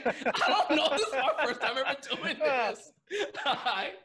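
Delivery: high, loud laughing; cracking up over the memory. Real, not acted. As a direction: laugh hard through the words. Laughing retelling his answer.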